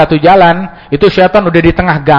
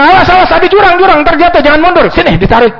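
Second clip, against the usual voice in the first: frantic warning shout; 12 semitones higher, faster than usual. Acted. Friend warning about a ravine, frantic high shout. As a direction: yell him back from the edge.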